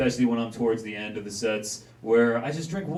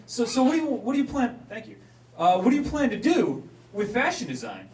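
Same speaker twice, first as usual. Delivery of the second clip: higher polite voice; 9 semitones higher, 4 dB louder than usual. Retold or imitated. Asking Vanessa, higher voice.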